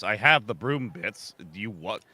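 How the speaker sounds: ordinary delivery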